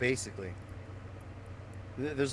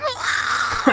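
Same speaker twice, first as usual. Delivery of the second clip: loud, high-pitched imitated scream; freak-out scream; 9 dB louder than usual. Player imitating a friend crashing at the finish, freak-out scream. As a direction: scream like a frustrated gamer losing it. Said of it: "he freaked the fuck out".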